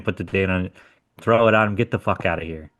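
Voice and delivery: ordinary delivery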